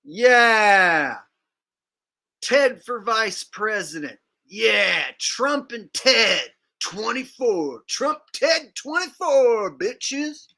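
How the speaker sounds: drawn-out, rising fake campaign whoop; hammed-up slogan shouting, climbing high; mock rally cheer. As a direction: cheer like an over-the-top rally fan. Mock campaign cheer after the clip.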